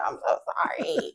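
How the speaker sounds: hushed, whispered quote of herself; whispered apology. Retold or imitated. Mother tells her son she is sorry, whispered.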